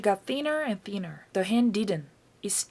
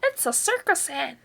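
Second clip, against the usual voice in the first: put-on higher, gleeful old woman's shout; grandma shouting delighted; 6 semitones higher than usual. A narrator's reading. Grandma on the roof delighted by the hen, gleeful shout. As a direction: crow with delight from above. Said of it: "Grandma shouted from the rooftop".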